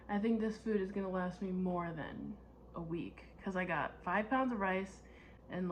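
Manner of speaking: ordinary delivery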